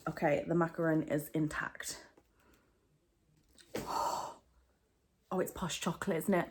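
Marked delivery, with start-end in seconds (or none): 3.7-4.3 sharp awed intake of breath; gasp. Real, not acted. Vlogger unboxing the posh chocolate bath bomb, awed gasp. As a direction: gasp at a lovely discovery.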